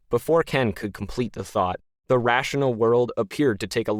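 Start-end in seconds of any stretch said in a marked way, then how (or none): none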